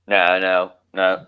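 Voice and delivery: ordinary talk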